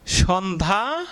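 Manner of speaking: slow teaching demo of Sandhya; drawn out slowly. Shown as a demonstration. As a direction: stretch the word Sandhya slowly as a whole. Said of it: "যদি লেখি".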